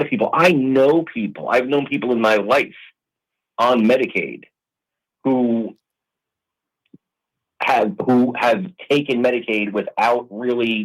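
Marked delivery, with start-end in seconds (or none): none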